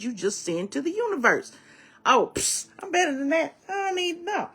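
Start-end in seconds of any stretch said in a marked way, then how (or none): 2.0-4.5 high-pitched mocking scoff; mock dismissive. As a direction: brush it off in a high, haughty voice. Mimics a haughty brush-off to the universe.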